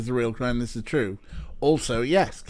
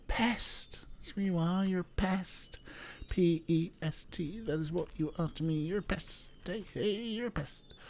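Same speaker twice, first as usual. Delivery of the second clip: soft, higher-pitched cooing taunt; affectionate teasing; 4 semitones higher, 9 dB quieter than usual. Real, not acted. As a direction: tease a beloved little pest very softly. Teasing a little pest nearby.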